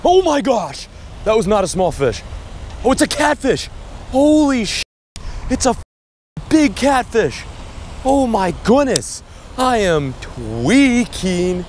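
real stunned excitement; excited shouting. Real, not acted. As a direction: yell in disbelief at the catch. Hooks a big catfish.

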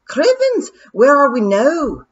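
high shouted Scottish pixie cry. Acted. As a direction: yelp it out like a startled wee pixie. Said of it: "said daft Wooly".

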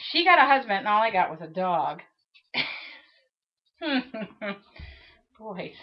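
ordinary talk

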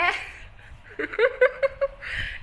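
airy high burst of laughter; real amused laugh. Real, not acted. Laughing at being called old.

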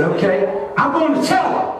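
mock grudging. Preacher giving in after slamming truck door, mock grudging. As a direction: give in like a sulking child.

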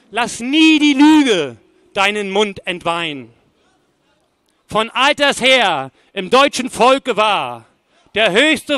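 loud, declamatory verse with weighty pauses; forceful poem recitation. Acted. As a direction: declaim it like a stern admonition to a child. Recites second poem to a child.

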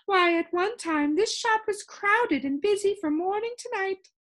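put-on the sound keeper telling Milo about the old shop, high and loud. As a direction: boast of the busy old days up high.

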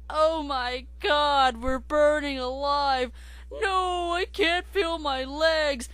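slow, high, loud anguished wail; burning scream. Acted. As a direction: wail like you're burning alive. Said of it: "your family's screaming".

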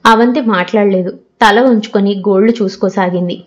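ordinary delivery, nothing marked

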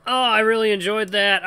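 loud, high cry of real delight; high-pitched, full-voiced enthusiasm; thrilled outburst. Real, not acted. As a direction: belt it out, thoroughly pleased. Excited reaction as the game ends.

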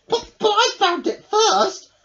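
high, loud mild huff; huffy. Acted. Hedgehog argues with Rabbit, mildly huffing. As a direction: stamp a little in protest. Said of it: "huffed Hedgehog".

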